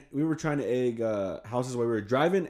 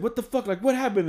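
demand; 10 semitones higher than usual. Retold or imitated. Alex demands answer.

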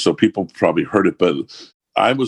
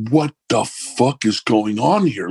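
higher, slow, a stunned rocker; stunned disbelief; 6 semitones higher, slower than usual. Retold or imitated. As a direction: drag it out, totally blown away. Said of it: "he was completely blown away".

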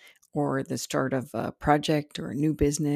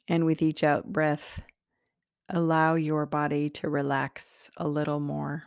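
guided meditation. Acted. Meditation host leading listeners into their heart center, slowly and calmly. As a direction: guide them gently inward, unhurried and spacious.

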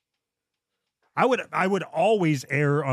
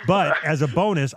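ordinary delivery

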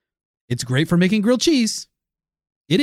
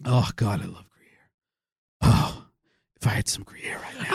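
whispered with longing for cheese; 9 semitones lower, 5 dB quieter than usual. Real, not acted. Craving cheese, he whispers to his cohost. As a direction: breathe it out like a craving.

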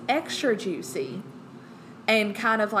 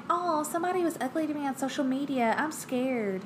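whiny self mock; 6 semitones higher than usual. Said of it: "I'm like".

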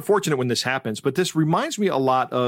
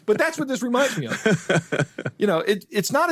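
laughing; 8 semitones higher than usual. Real, not acted. Host recovering from his Simpsons joke, laughing. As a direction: still giggling, trying to get back on track.

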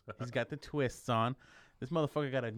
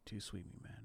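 confidential request; 13 dB quieter than usual. Retold or imitated. Security guard quietly asking a fellow fan for a greeting, confidential. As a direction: drop it to a hushed aside.

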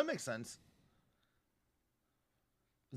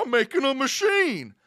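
higher, louder odd voice; crazed twin; 11 semitones higher, 9 dB louder than usual. Retold or imitated. Troy on the voicemail, voiced high and loud. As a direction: announce it like a mad tinkerer. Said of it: "He's like".

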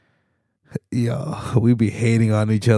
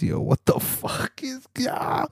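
high, drawn-out disbelieving gasp of a question; stunned disbelief; 6 dB quieter, slower than usual. Real, not acted. Reading the headline about Snow, a high stunned disbelief. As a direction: react like you can't believe what you just read.

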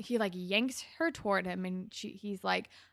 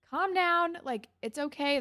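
high, louder placating voice; mock soothing Terry; 8 semitones higher, 4 dB louder than usual. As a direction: coo it to calm him down. Terry soothing Rick, imitated.

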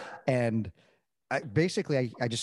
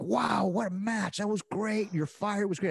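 high, slow, awed praise; impressed young guys; 9 semitones higher, slower than usual. Retold or imitated. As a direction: gush over the match like a rookie. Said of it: "the younger guys like".

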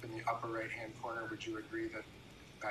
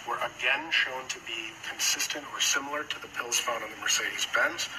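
formal speech; 11 dB louder than usual. Acted. Prosecutor's opening statement, loud.

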